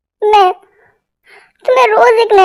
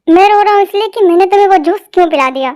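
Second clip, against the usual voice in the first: rushed; faster than usual. Acted. Husband explains in a rush.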